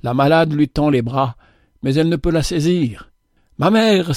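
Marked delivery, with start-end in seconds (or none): 3.6-4.1 higher-pitched, yearning exclamation; mild cry on a raised pitch. A narrator's reading. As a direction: cry out for a mother. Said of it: "s'écrie-t-elle".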